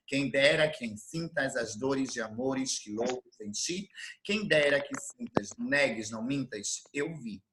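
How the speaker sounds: recitar devagar, baixinho, quase sussurrando; recitação de poema. Acted. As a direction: declamar como uma canção suave. Recites poem example for rhythm. (recitando o poema em voz baixa e ritmada)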